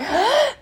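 sharp, high mock gasp; acted frightened gasp. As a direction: gasp as if you just saw something. Retold sight of something frightening.

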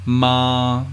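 "ma" held long on one even pitch; level first tone. Shown as a demonstration. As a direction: say "ma" sustained and flat, no rise or fall. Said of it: "also known as level tone".

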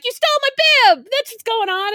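high, loud accusing yell; angry yell. Retold or imitated. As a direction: snap it back at him. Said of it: "I'm like".

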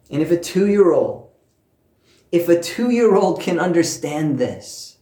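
normal talking voice

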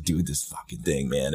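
soft, uneasy grumble to himself; tense hushed mutter. Retold or imitated. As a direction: mutter it like the thing might hear. Scared drive, muttered.

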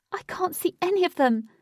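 high, loud anxious woman. A narrator's reading. Anxious woman scanning the lake, high and worried. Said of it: "said an anxious woman".

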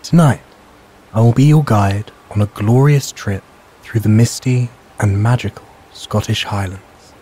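ordinary delivery

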